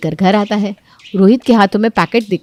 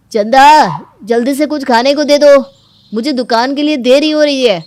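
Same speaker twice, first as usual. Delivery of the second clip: louder, higher hurried call; hurried husband calling; 5 semitones higher, 5 dB louder than usual. Acted. Husband calling Chanda for breakfast, hurried. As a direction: call out in a rush.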